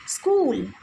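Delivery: स्कूल with a drawn-out oo; long vowel. Shown as a demonstration. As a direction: hold the oo in स्कूल. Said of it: "ये long sound जाता है".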